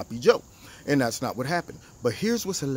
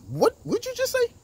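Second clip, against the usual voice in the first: outraged squeak, much higher; a shocked coworker snapping back, much higher; shocked woman. Retold or imitated. Offended lady at the job, shrill high disbelief. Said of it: "She's like".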